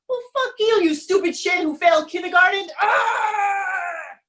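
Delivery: high, furious shouting match; brothers yelling. Acted. Two brothers screaming insults at each other.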